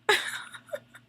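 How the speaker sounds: high squeaky giggle; delighted high laugh. Real, not acted. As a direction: let out a bright little squeal of laughter. Laughing at sweet moment.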